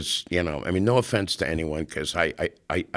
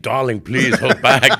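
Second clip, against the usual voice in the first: slow, louder camp plea with a laugh; Clement pleading, laughing; 6 semitones higher, 9 dB louder, slower than usual. Retold or imitated. Clement holding back his attacking partner, slow laughing plea. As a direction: rein her in, darling, half laughing. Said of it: "Clement's like".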